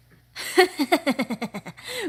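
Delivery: loud, high gloating villain cackle; evil laugh. Acted. Villain gloating over weakling wolves, loud evil laugh. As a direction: laugh in triumph over them. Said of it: "a lot of sinister tones".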